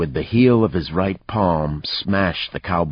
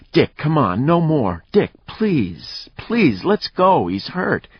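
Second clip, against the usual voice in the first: high, urgent begging; desperate pleading; 11 semitones higher, 3 dB louder, slower than usual. A narrator's reading. Woman tugging her man away from a beating, pleading. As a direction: plead with him to stop.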